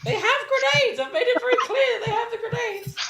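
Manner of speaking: high, laughing announcement; gleeful laughing shout; laughing exclaim. Real, not acted. GM laughing at own reveal.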